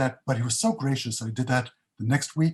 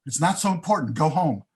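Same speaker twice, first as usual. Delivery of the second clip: higher, louder insistent push; urgent insistence; 5 semitones higher, 5 dB louder than usual. Retold or imitated. Bob urging Jay to go home for Shabbos, louder and insistent. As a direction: shoo him out the door. Friend urging him to leave.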